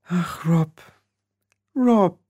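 high, plaintive sigh of his name; high-pitched sorrow; tearful. A narrator's reading. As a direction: plead tenderly, near tears. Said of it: "begann zu weinen".

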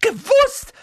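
sehr hoch und laut herausschreien; plötzlich losbrüllen; schrill aufschreien. A narrator's reading. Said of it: "schrie Tante Petunia plötzlich auf". (Tante Petunia schreit Harry plötzlich an)

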